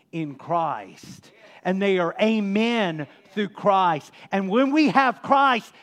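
loud, ringing preacher's cry; preaching at full force. Acted. Pastor preaching loudly to his congregation. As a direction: proclaim it to the whole church.

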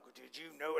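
low, soft confiding aside; hushed gossip. Acted. Acted coworker spreading a complaint round the office, hushed. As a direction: pass it on under your breath.

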